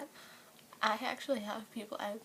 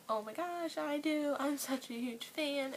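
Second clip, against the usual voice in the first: excited fangirl; 5 semitones higher than usual. Retold or imitated. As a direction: gush it out like you just got noticed. Fan thrilled at Jake's tweet.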